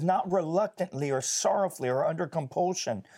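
earnest preacher reading the Amplified Bible aloud; fervent scripture reading. Acted. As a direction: read the verse like a sermon building. Reads scripture with fervour.

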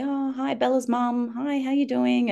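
put-on high sing-out children's hello; imitated kids greeting. Classmates greeting Bella's mum, high imitated child voices. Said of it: "they'll say".

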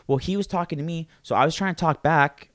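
high, loud mock-innocent excuse; mocking the woman. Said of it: "the way she, like, mentioned it to me was".

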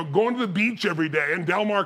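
normal talking voice

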